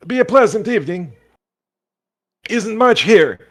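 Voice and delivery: put-on squeaky cheerful old boggart greeting travellers; folksy old boggart. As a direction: play the friendly old fisherman by the fire. GM continues as the boggart.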